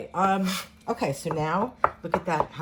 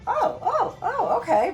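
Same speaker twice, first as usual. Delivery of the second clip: high, loud imitation of an impressed old lady's "oh, oh, okay"; mock impressed; 6 dB louder than usual. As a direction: act out being very impressed by the pasta list. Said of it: "Very impressed".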